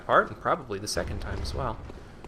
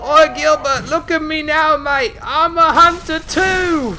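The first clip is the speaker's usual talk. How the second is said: much higher, louder, slower mock Cockney hunter; bad Cockney accent; 11 dB louder, slower than usual. Mocking a townsman bragging in a bad Cockney accent. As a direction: ham up a terrible Cockney bloke boasting. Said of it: "That was probably a terrible impression".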